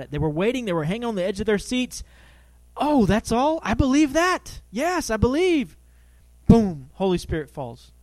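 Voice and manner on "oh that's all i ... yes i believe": high, loud, eager "I believe that"; imitating eager listeners who instantly believe; eager believing. Eager listeners voiced in retelling.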